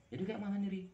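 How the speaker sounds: high, anxious friend's question; worried friend. Retold or imitated. A friend, just arrived, asking the narrator what to do, high and anxious. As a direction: play the panicked friend asking what now.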